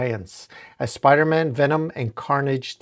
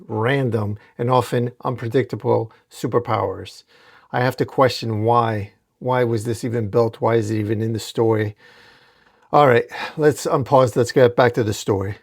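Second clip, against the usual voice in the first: usual speaking voice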